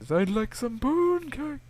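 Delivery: high, slow goofy voice; reading a viewer comment in a goofy high voice; silly read comment. Acted. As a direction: read it as a dopey character, way up high. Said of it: "that's actually a comment".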